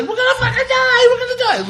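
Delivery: hammed-up tearful panicky squeal; crying scream. Little kid pounding on the shop door, imitated crying scream. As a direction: imitate a terrified kid wailing, very high-pitched. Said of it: "He's crying".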